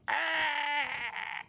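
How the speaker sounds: high mock creature yell; imitating a yell. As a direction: squeal like the startled mob. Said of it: "He didn't need to yell at me".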